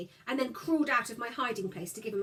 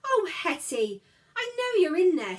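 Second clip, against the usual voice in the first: higher, louder coaxing call; coaxing brother; 8 semitones higher, 8 dB louder than usual. A narrator's reading. Brother coaxing his hiding sister, raised voice. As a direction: coax her out, lightly voiced boy.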